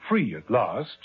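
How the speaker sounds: usual speaking voice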